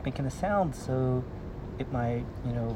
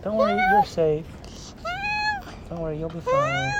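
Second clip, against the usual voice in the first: high soothing voice. Acted. Soothing the cat.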